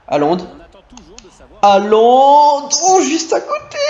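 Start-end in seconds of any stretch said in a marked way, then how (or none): 1.6-3.8 high, loud excited yell at near miss; excited shout. Real, not acted. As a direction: shout the name as the shot flies. Near goal in his match.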